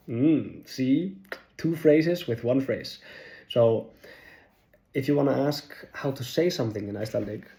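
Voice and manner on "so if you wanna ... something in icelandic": normal talking voice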